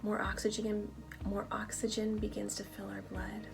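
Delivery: calm them down, one breath at a time; guided meditation. Acted. Guided meditation over music.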